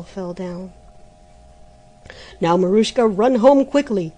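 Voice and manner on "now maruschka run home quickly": shouted. Acted. The month shouting at the girl to go home. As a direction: shout the order across the hill. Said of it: "shouted the month".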